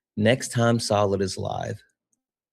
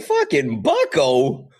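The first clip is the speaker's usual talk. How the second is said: disbelief; 4 semitones higher, slower than usual. Retold or imitated. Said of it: "I was like".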